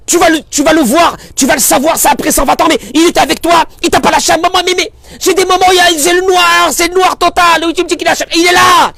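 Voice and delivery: rising to a loud high preaching shout; passionate preaching. Real, not acted. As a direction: cry it out with full conviction. Rabbin prêche avec force.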